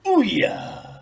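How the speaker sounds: high, loud delighted cry; loud high ooh yeah; gleeful ooh. Acted. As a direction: relish it. Gleeful exclamation.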